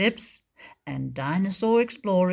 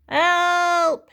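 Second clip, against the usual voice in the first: loud high shout for help; cry for help; 11 semitones higher, 7 dB louder than usual. Acted. Trapped young dinosaur crying out to the others, loud high shout. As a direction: yell it out, trapped. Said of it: "he heard Tyro cry again".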